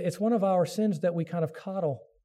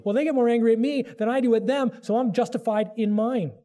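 higher, louder defensive excuse; self-justifying protest; 7 semitones higher, 4 dB louder than usual. Acted. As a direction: argue your own case, voice pitched up. Voicing a spouse's self-justifying excuse.

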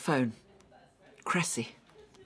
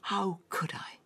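pained whisper; 6 semitones higher than usual. Acted. Answering Sven's reproach.

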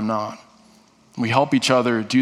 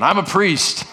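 raised declaration; 7 semitones higher than usual. Shown as a demonstration. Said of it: "Say it again".

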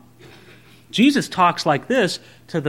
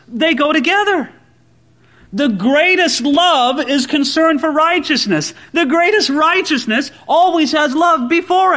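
high-pitched, driving pulpit delivery; forceful preaching; 7 semitones higher than usual. Real, not acted. Preacher urging friends that love and righteousness go together, forceful preaching. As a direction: press each point home in a raised voice.

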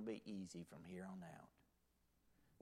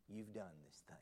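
whispered, softer accusation; hushed accusation; 4 semitones lower, 7 dB quieter than usual. Retold or imitated. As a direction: whisper the verdict straight at him. Prophet confronts King David.

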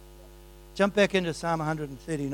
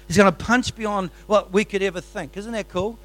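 excited; 6 semitones higher, 9 dB louder than usual. Real, not acted. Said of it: "Sorry, I'm getting excited".

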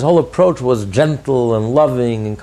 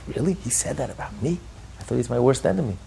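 a touch of disbelief, much softer; surprised, almost to himself; mock surprise; 8 dB quieter than usual. Hushed disbelief as the man hears he was praised.

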